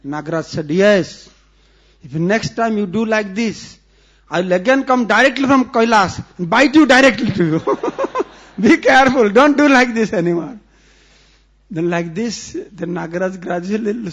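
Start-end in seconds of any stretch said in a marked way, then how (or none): 0.7-10.6 loud mock threat, half laughing; a laughing, loud snake king's threat; stern warning. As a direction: warn them never to do it again.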